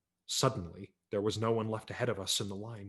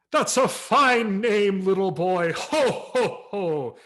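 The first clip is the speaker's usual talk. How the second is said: slow, loud, much higher Santa voice; jolly Santa; 9 semitones higher, 9 dB louder, much slower than usual. A narrator's reading. Santa praising the boy's name, jolly boom. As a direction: play Santa jolly and big.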